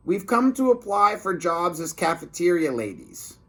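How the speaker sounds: high put-on sneaky lunch lady; high-pitched scheming cafeteria lady. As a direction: play the disguised villain lady, sweet and fake. Reading lunch lady villain.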